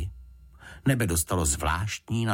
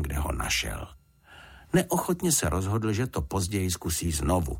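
plain reading voice

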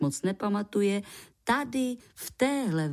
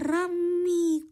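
surprised call; 10 semitones higher than usual. Acted. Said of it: "zvolal překvapeně". (překvapené zvolání vysokým hlasem)

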